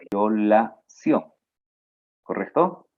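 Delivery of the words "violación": violación drawn out slowly with a falling end; slow answer. Shown as a demonstration. Teacher revealing the derived word, slowly. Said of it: "Su derivación va a ser cuál".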